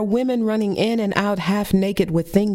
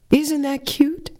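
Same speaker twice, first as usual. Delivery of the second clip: mother's voice; 8 semitones higher, slower than usual. Retold or imitated. Narrator voices her mother in bra shop.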